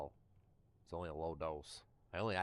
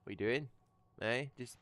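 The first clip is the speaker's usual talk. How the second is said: higher, louder teasing question to the dog; pet talk; 7 semitones higher, 4 dB louder than usual. Real, not acted. Asking the dog what he's up to, higher playful pet talk.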